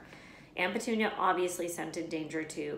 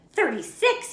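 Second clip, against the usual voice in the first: Dudley voice; 10 semitones higher, 10 dB louder than usual. A narrator's reading. Dudley voiced counting presents.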